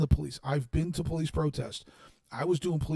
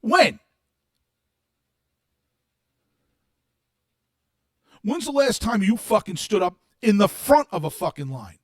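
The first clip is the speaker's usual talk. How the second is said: loud, higher, raging yell; furious shouting; 3 semitones higher, 7 dB louder than usual. Real, not acted. Daring online critics to face him, furious shouting. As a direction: tear into them at full volume.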